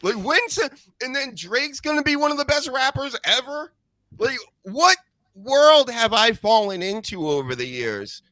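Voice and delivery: high-pitched incredulous venting; exasperated rant. Real, not acted. Sports host venting about an overpraised punter, exasperated. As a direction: blow off steam in high, stretched questions. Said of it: "I gotta blow off some steam".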